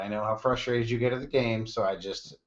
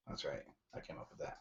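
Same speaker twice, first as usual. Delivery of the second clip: hushed aside; 7 semitones lower, 18 dB quieter, faster than usual. Real, not acted. Aside after jingle.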